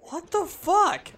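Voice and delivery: high, slow disbelieving squeal; shocked. Real, not acted. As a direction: stretch out the shock. Sudden in-game shock.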